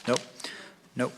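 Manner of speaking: normal talking voice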